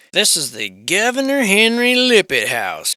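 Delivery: high and loud, with a hint of a tour-guide flourish; a touch of grand presenter; mock grand announcement. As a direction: announce the house name like a lightly hammed-up show host. Reading out the house title.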